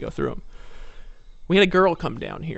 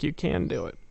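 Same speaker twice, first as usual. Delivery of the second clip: quieter, coaxing; soft encouragement; 6 dB quieter than usual. Retold or imitated. Coaxing a scared woman forward, softly. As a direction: gently reassure her. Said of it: "I was like".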